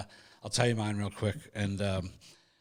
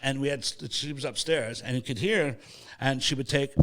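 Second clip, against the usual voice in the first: ordinary talk, nothing marked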